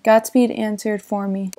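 ordinary delivery

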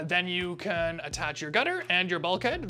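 ordinary delivery

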